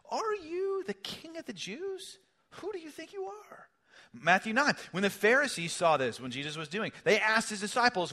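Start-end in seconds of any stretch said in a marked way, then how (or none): 0.1-3.7 much higher, slower, mocking; mock Pilate. Preacher voicing Pilate taunting Jesus, high mocking question. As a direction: taunt him like a sneering governor. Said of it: "Pilate asked him".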